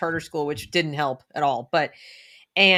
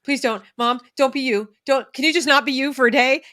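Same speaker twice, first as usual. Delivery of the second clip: put-on higher, louder embarrassed teen begging; pleading kid; 7 semitones higher, 6 dB louder than usual. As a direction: whine at mom to leave the school. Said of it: "And my kid would be like".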